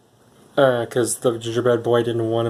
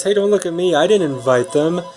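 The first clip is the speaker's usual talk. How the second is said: higher, defensive protest, lightly acted; mock-defensive, pitch lifted to deny blame; Donkey; 5 semitones higher than usual. Talking donkey denies inviting the guests, higher and defensive. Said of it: "Donkey goes".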